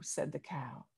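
put-on lively read-aloud voice for children; story narration. A narrator's reading. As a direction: tell it like a picture book to a class. Reads picture book aloud.

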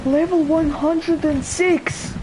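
high, thrilled readout; excited announcement. Real, not acted. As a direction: proudly call out the number. Announces egg level.

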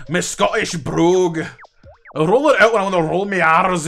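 Scottish accent with rolled R's; Scottish brogue. Shown as a demonstration. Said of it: "talk like this".

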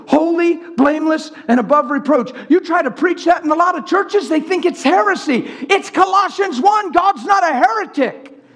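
high, rising sermon cry; fervent preaching. Acted. Preacher answering churches that call it heresy, fervent preaching. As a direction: build to a high, forceful declaration.

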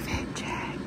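hushed whisper so as not to wake a roommate. Real, not acted. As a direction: whisper it all, barely a breath. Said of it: "I have to whisper 'cause Mary's still sleeping".

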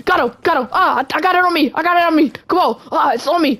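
high, frantic running commentary; excited exclaiming. Pretend. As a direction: exclaim in a playful panic while fleeing. Paint hit, fleeing to hide.

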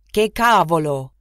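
an Italian oath shouted out; loud curse. A narrator's reading. Italian driver swearing at a woman in the road, loud. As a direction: curse loudly at the pedestrian. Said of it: "rief er laut".

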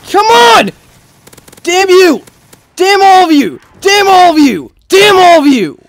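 high, loud repeated curses; furious gamer shouting; angry cursing. Real, not acted. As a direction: yell each curse in exasperated fury. Curses enemies after failing.

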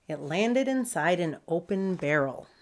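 usual speaking voice

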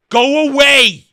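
loud, high, drawn-out yell; exasperated shout. Real, not acted. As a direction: bellow it slowly in sheer exasperation. Exasperated rant at the director.

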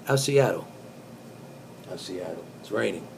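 ordinary delivery

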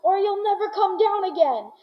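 hammed-up shouting upward; retold shout. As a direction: call up to him in mock alarm. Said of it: "I stood there shouting".

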